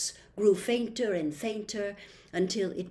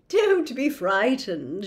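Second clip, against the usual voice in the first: laughing; 3 semitones higher, 5 dB louder, slower than usual. A narrator's reading. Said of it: "she laughed".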